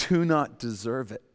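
ordinary talk, nothing marked

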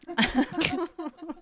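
laughing. Real, not acted. Laughs at joke.

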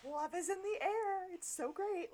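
mock giddy romantic host; bubbly host lady. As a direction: float around like love's in the air. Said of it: "she's walking around like".

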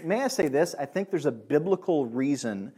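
ordinary talk

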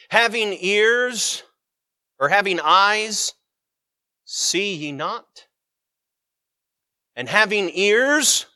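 slow, deliberate, weighty reading of the verse; preacher reading the rebuke to the disciples; solemn scripture reading. Acted. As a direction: let each phrase land slowly.

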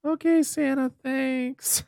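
put-on high, breathy little girl. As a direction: play a sweet small girl, up high. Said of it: "she's just like".

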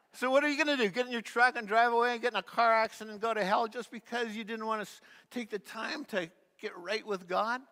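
loud, high, rushing rebuke; indignant outburst. Retold or imitated. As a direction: hammer him with it, fast and fierce. [name] confronts the departing hillbilly.

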